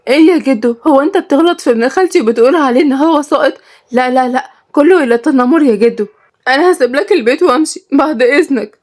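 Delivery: high, hammed-up tearful protest; fake tears. Shorouq fake-crying at grandfather over her cousin, mock-tearful. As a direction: act wounded in a high, mock-weepy voice. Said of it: "شروق اتكلمت بدموع التمثيل".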